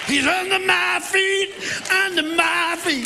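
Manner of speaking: high, loud, slow shouted singing; shout-sing it with everything; full-force shout. Retold or imitated. Worship leader repenting all the way, shout-singing the song. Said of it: "I did it with everything".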